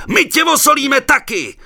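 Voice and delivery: loud high bellowed threat; commander bellowing. A narrator's reading. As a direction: roar it at him. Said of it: "hulákal velitel".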